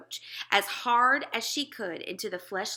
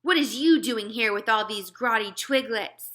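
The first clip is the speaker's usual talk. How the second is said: louder suspicious bellow; giant bellowing; 3 semitones higher, 8 dB louder than usual. Acted. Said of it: "he bellowed".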